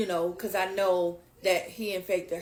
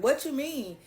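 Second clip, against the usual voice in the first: sassy, higher-pitched challenge; imitated sassy retort; 5 semitones higher than usual. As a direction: mimic a sassy, disbelieving comeback. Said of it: "real sassy with it and was like".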